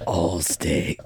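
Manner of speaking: very low, demonic Allstate announcer; attempted deep voice. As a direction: force it far down for a badly faked ad voice. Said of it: "I can't get a deep voice".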